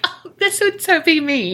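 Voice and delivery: excited squeal. Acted. Excitedly relating to gossiping ghost.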